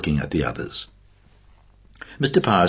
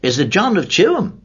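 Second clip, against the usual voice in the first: astonished; 14 semitones higher, 5 dB louder than usual. Acted. Kernan's astonished follow-up question.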